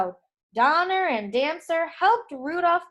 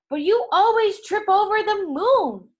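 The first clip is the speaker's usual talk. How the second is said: higher, louder jab back; mocking retort; 8 semitones higher, 6 dB louder than usual. Dancer taunting Dasher during the quarrel, higher and louder. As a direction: fire the insult back over the moon. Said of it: "kicking a fallen star".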